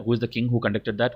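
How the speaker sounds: ordinary talk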